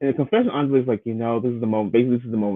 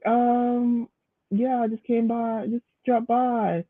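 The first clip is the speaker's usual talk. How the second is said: lightly voiced, higher, hesitant excuse; sheepish mock excuse; 10 semitones higher, slower than usual. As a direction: fake a casual excuse, pitched up. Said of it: "he's like".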